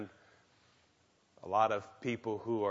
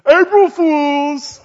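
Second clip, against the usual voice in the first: high, loud, slow gleeful reveal; brother's gotcha; 20 dB louder, much slower than usual. Retold or imitated. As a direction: drag it out triumphantly. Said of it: "He, he said".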